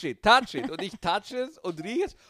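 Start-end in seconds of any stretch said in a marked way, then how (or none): 0.0-0.7 high, loud pushy urging; urging vendor. Retold or imitated. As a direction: push them to touch it. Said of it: "Er hat gesagt".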